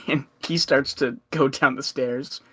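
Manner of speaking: usual speaking voice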